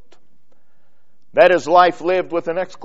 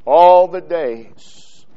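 ordinary delivery

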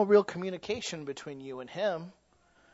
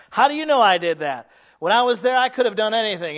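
loud mock suspect; 6 semitones higher, 12 dB louder than usual. Mocking the suspect's defence.

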